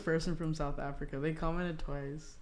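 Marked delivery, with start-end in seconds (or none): 1.2-2.3 soft, slow whisper; whispered aside. Real, not acted. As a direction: whisper it to yourself. Aside while reading.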